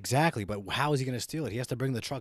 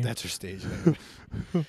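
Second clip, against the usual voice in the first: slow drawn-out; 2 semitones higher, 6 dB louder, much slower than usual. Pretend. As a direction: drawl it out, very slow. Joking repeat of cohost.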